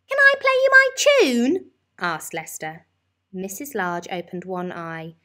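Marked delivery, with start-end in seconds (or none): 0.1-1.7 put-on very high, loud child's voice; eager child. A narrator's reading. Lester asking his mum to hear his tune, high and eager. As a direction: ask brightly like a small boy.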